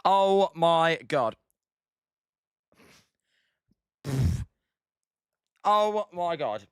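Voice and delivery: slow, drawn-out real disbelief; stunned reeling. Real, not acted. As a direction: drag it out, reeling from smelling salts. Recovering from smelling salts.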